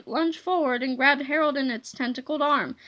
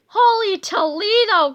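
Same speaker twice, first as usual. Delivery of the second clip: high, loud exclamation; astonished cry; 5 semitones higher, 6 dB louder than usual. A narrator's reading. Man meets his younger self, crying out astonished. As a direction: cry out in amazement. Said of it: "cried old George".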